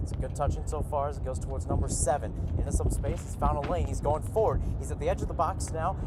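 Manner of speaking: live match call, steady and quiet; play-by-play. Acted.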